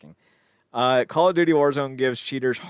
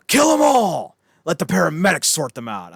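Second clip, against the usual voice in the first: loud, high battle cry easing slightly; psychopath linebacker's yell; 5 semitones higher, 8 dB louder than usual. Retold or imitated. Quoting the psychopath linebacker's great movie line, loud yell. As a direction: bellow it like a rallying order. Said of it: "the linebacker has this great line".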